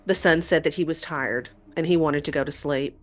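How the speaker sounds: ordinary talk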